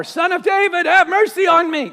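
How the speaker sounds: loud pleading shout. Acted. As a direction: cry out even harder, begging. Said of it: "he cried out all the more".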